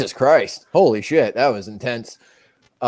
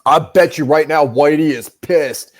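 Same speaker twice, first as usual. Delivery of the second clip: normal talking voice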